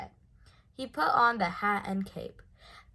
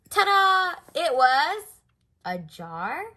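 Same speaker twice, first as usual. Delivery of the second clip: much higher and louder triumphant announcement; ta-da reveal; 6 semitones higher, 9 dB louder, slower than usual. A narrator's reading. Bear cub triumphantly revealing his trick to the guests. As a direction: present it like a showman. Bear's big magic reveal.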